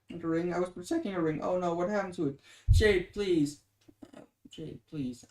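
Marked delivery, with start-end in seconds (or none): 2.7-3.6 pleading Jade, louder, urgently; loud plea. Acted. Pleading, then repeated softly. 4.5-5.2 pleading Jade, softer, almost under the breath; soft plea. Acted. Pleading, then repeated softly.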